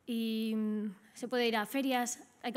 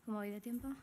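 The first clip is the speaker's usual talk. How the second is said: softer off-mic check on time; quiet aside; 8 dB quieter, faster than usual. Real, not acted. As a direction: drop the voice for a quick practical question.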